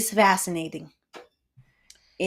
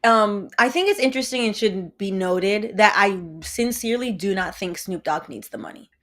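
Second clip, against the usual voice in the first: usual speaking voice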